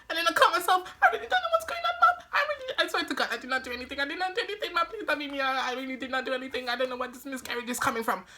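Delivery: high, frantic hammed-up begging; fake dramatic pleading. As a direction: overplay the innocent child begging not to be beaten. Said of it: "all this drama faking".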